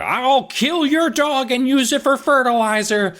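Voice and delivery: a hammed-up grumpy neighbor threatening; cranky neighbor threat. Neighbor threatening the kids' dog, hammed-up menace.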